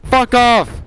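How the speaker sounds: loud high panicked swear; scared yell. Real, not acted. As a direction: shout it out in fright. Yelling at the chasing monster.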